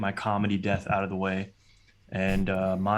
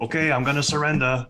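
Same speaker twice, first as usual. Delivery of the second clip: higher, louder mock giving up; mock surrender; 6 semitones higher, 5 dB louder than usual. Robber pretending to give up to police, mock voice. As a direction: fake a surrender. Mock quote of movie hero.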